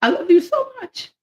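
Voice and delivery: real crying love to mom; tearful. Real, not acted. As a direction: voice cracking with love. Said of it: "So if I start crying".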